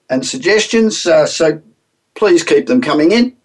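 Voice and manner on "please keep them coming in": usual speaking voice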